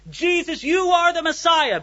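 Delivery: high, ringing acted proclamation; loud proclaiming. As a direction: proclaim it openly, voice pitched right up. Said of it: "very loudly proclaiming".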